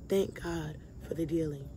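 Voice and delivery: low, soft thanks to God; quiet thanks. Real, not acted. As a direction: say it softly, settling. Quiet thanks before whispered repeat.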